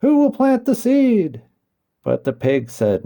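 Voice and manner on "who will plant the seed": high, loud hen calling out. A narrator's reading. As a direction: call it out across the barnyard. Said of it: "she called loudly".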